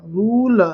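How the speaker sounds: "रूल" stretched on a long oo. Shown as a demonstration. Teacher repeating the big ऊ sign, "रूल" with long vowel. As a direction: hold the ऊ in "रूल", loudly. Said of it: "बड़ा ऊ की मात्रा".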